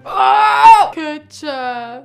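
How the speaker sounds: startled cry. Acted. Alarm as new emotions arrive.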